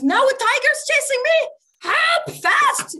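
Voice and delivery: high, loud cry for help; acted urgent alarm; panicked cat. Cat to the yogi with a tiger behind it, panicked.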